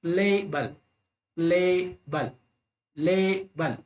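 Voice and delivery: Label split in two, LAY drawn out, bul dropping; stress on first syllable. Shown as a demonstration. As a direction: lean on the ले of label, let बल fall. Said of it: "पहला syllable हमेशा stressed होना चाहिए".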